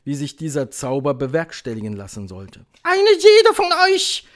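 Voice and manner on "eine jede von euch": high, loud, slow command; thundering Grand High Witch. A narrator's reading. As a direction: thunder it shrilly, word by word. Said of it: "donnerte die Hoch". (donnernde Hexe, gedehnt und laut)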